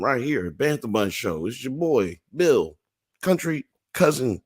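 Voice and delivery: hyped-up show host voice; announcer sign-off. Acted. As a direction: pump up the outro like a host. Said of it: "show sign-off announcement".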